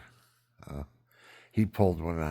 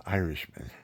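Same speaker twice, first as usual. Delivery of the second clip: ordinary talk, nothing marked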